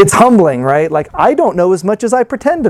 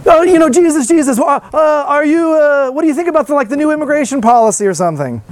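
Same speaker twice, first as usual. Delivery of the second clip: a hammed-up higher voice, fumbling for words; mock reporter, higher; 6 semitones higher than usual. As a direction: play a flustered reporter stumbling through a question. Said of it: "reporter asks Jesus".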